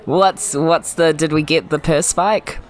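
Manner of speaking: ordinary delivery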